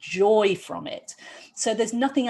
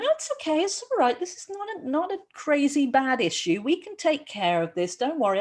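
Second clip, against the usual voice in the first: reassuring; 6 semitones higher than usual. Retold or imitated. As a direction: soothe a worried patient, starting high and light. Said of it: "reassuring them".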